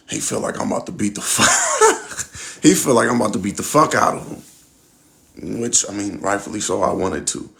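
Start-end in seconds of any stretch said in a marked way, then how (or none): 0.1-4.4 laughing. Real, not acted. Laughing about the informant's fear of a beating. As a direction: crack up as you tell it.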